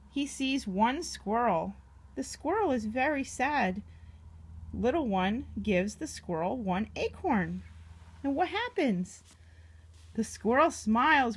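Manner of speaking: usual speaking voice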